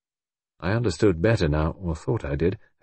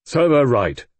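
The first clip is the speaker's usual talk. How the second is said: loud, high, harsh retort; vindictive retort; 5 semitones higher, 6 dB louder, slower than usual. A narrator's reading. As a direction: snap it out without pity.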